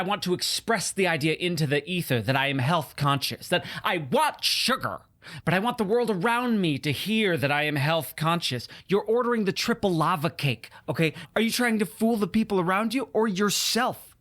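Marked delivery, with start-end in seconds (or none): none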